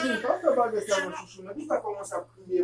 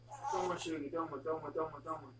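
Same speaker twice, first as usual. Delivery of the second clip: soft, low repeated hushing; soothing murmur; 4 semitones lower, 10 dB quieter than usual. Real, not acted. Parent asking a child to wait, quiet soothing murmur. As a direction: keep it low and gentle, repeating.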